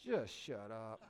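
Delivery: low, soft, fed-up dismissal; exasperated aside. Acted. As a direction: drop it under the boast, fed up. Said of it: "I'm like".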